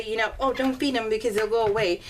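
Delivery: usual speaking voice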